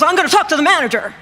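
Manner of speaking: fast, high, indignant announcement; huffy threat. Retold or imitated. Clerk going off to fetch the manager, angry and quick.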